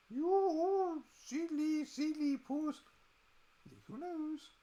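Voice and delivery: high, slow cooing at a pet. Real, not acted. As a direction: coo at the cat sweetly. Baby talk to the cat.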